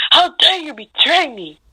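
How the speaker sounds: high, slow outraged cry; betrayed fury. Acted. Betrayed fox yells at Naruto.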